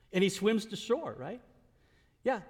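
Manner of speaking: ordinary talk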